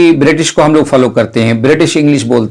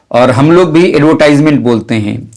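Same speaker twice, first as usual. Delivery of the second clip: ordinary delivery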